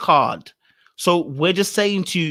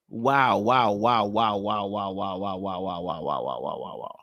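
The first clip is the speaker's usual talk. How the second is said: low wows fading to nothing; dazed, dwindling low murmur; stunned disbelief; 10 semitones lower, 7 dB quieter, slower than usual. Acted. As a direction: repeat it, sinking steadily in pitch and volume. Stunned muttering that fades away.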